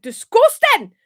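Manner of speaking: loud, high Scottish catchphrase; Scottish accent. Acted. Showing the quote from an old Vine, shouted Scottish accent. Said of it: "This is what I quote all the time".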